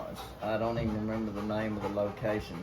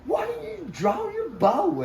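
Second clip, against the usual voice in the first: angry at son. Retold or imitated. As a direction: chew your son out in the field. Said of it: "really angry".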